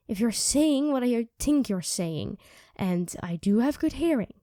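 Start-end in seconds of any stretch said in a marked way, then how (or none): none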